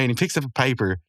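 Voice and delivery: ordinary talk, nothing marked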